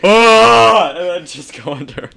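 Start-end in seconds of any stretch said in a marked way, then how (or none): 0.0-0.9 high, loud silly yelp; whale leap yell. Retold or imitated. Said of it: "I'm like".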